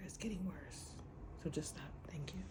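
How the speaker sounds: dropped to a whisper; whispered brush-off; quiet whisper. Real, not acted. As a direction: hush it, done with him. Dismissive closing of rant.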